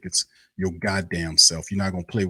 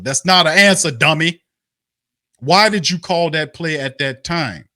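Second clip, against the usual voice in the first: louder and higher, snapping at the coach; angry yell; 6 semitones higher, 6 dB louder than usual. Real, not acted. Fan yelling at the coach about his non-answer, angry. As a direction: shout him down, he dodged the question.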